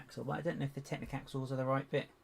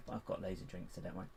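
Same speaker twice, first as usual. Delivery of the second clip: ordinary delivery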